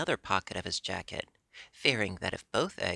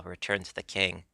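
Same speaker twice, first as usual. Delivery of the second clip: plain reading voice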